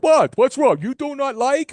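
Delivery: high, loud put-on waiter voice, broken English; high mock-foreign waiter asking what's wrong. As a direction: ham up the pushy waiter, high-pitched and loud. Retelling the waiter's pushy question.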